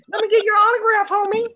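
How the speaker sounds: very high put-on fanboy voice; mock starstruck fan. Friend teasing internet celebrity for autograph, mock fanboy squeal.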